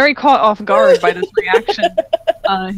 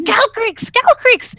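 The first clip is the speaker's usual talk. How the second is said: high, far-off frantic scream of a name; 14 semitones higher, slower than usual. Acted. Lizard runs to his dragon lover, screaming the name. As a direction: shriek the name, arms spread.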